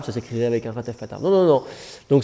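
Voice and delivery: ordinary talk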